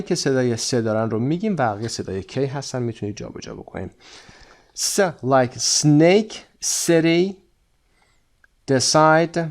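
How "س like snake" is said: "س" as a snake-like S, then "like snake" drawn out; S sound. Shown as a demonstration. Teacher models the sound for students, slow S sound in "س، like snake". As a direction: say "س، like snake" slowly, showing the S.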